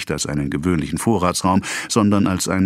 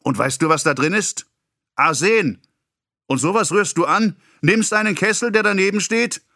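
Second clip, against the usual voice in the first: higher, building indignation; accusing lecture; 10 semitones higher than usual. A narrator's reading. Pharmacist lecturing the boy about the poison jar, higher. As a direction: hammer the danger home.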